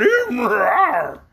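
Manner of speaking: high, loud imitated hee-haw; spoken imitation of a donkey's bray. A narrator's reading. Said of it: "as loud as he could".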